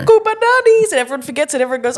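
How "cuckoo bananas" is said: much higher, louder exclamation; loud silly outburst; exclaimed. Real, not acted. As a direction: burst it out wildly.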